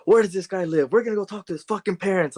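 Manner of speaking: high-pitched indignant mock rant; imitated angry dad. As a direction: play an outraged father demanding a confrontation. Retold angry dad demanding a confrontation.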